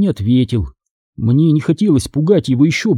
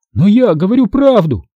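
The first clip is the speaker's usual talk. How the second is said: higher, pleading exclamation; insistent protest; 6 semitones higher than usual. A narrator's reading. Boy will not give up with father, insisting. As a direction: keep arguing, mildly heated. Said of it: "не унимался я".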